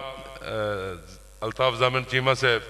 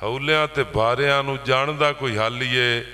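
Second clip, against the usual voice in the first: poetry recital; 7 semitones higher than usual. Acted. Poet lists plough parts to farmers, declaimed verse. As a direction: chime each rhyme in steady metre.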